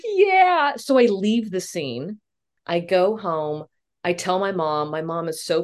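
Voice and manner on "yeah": high, wailed tearful reply; hammed-up sobbing assent; mock crying. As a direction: whine it out as if crying.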